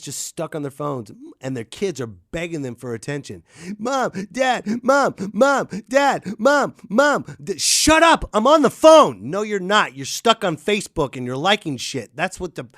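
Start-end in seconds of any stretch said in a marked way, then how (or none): 3.8-7.6 put-on nagging kid. A kid begging a parent stuck on the phone. 7.6-9.3 much higher, louder snap; mock snapping parent. A parent on the phone snapping at the begging kid.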